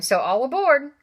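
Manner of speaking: high, loud all-aboard call; hearty call. Acted. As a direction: call them aboard like a captain. Vernon ordering everyone aboard.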